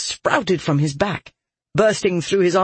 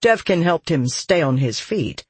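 ordinary delivery, nothing marked

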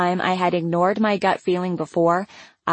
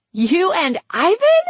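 high, drawn-out incredulous question; squealed, astonished question; amused disbelief; 11 semitones higher, 4 dB louder, slower than usual. A narrator's reading. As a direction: shoot the pitch right up at the end. Karina incredulous, about to laugh.

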